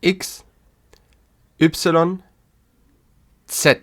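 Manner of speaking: each German letter said alone with pauses to repeat; teacher reading the German alphabet aloud. Shown as a demonstration. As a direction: read the alphabet out for learners to echo.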